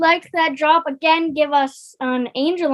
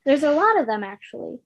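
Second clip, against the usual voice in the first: ordinary talk, nothing marked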